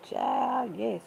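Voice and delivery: ordinary talk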